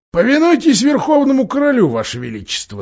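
high, loud dwarf rebuke, falling away at the end; insistent dwarf. A narrator's reading. Dwarf rebuking a queen who refuses to follow, insistent. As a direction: tell her off in a put-on dwarf voice.

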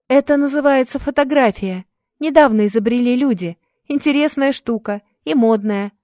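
put-on a bright young helper speaking; young woman voice. Maria voiced in story.

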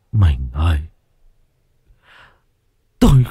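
slow, tearful longing; weeping husband. Acted. The husband weeps to his dead wife at the altar. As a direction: let it break into weeping. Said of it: "Ông lẳng lặng khóc".

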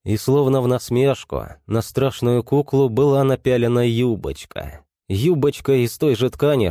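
ordinary delivery

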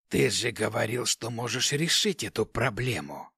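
low, dark, displeased rasp; displeased necromancer. A narrator's reading. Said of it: "Некромант был явно недоволен". (недовольный мрачный голос некроманта)

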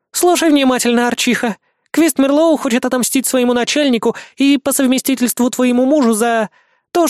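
put-on high, bossy devil girl; barbarian. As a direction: command her like a rude brat. Lana giving orders on phone.

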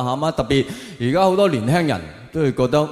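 usual speaking voice

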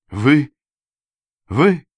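high, halting questions of disbelief; stunned babble. A narrator's reading. Narrator recognising his unexpected rescuer, stunned babble. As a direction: falter out each word in astonishment. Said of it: "пролепетал я".

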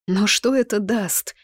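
lifted voice of mild bewilderment; puzzled. A narrator's reading. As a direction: ask it as if you can't see the point. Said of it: "не понимала я".

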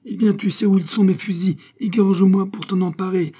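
high, loud challenge; furious dare. Acted. Abbé daring Pitou to take the guns, loud fury. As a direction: dare him to kill you.

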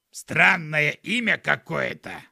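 loud, slow skunk voice; put-on animal voice, drawn out and loud; skunk character. A narrator's reading. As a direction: comment slowly as a talking skunk. Skunk on the shoulder comments.

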